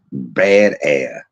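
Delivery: usual speaking voice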